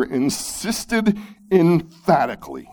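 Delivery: "insisted emphatically" with each word punched high, then falling; weighty, high stress on "insisted emphatically"; emphatic stress. Shown as a demonstration. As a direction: hit "insisted" and "emphatically" hard and separately. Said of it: "Did you catch that?".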